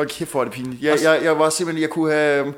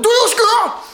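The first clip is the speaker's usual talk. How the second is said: much higher, louder mock yell at a colleague; retold shout; 5 dB louder than usual. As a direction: shriek it like an outraged coworker. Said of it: "Så råbte han".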